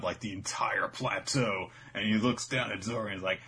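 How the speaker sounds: ordinary delivery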